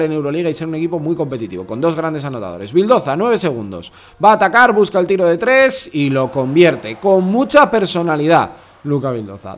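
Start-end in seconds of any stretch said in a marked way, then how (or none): 2.7-9.6 louder, higher, drawn-out call of the shot; play-by-play commentary. Acted. Commentator calls Vildoza's three for viewers, emphatic play-by-play. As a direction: build the call slowly as the shot drops.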